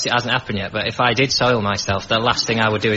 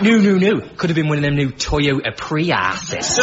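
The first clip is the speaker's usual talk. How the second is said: Birmingham dad; 4 semitones higher than usual. Retold or imitated. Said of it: "Or with that accent".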